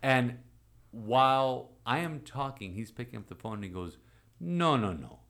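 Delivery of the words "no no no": much higher, louder mock bishop cutting in; imitating the bishop brushing aside the boy's doubts; insistent bishop. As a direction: stop him short and insist, like the bishop on the phone. Bishop cuts him off insisting.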